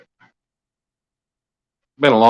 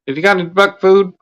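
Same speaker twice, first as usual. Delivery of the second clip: duck voice; 8 semitones higher than usual. Acted.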